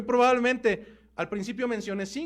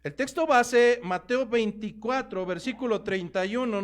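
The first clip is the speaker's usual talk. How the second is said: ordinary delivery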